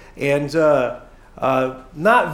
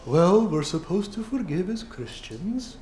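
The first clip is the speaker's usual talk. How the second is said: mock pious, soft and slow; 7 dB quieter than usual. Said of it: "you can be all pious".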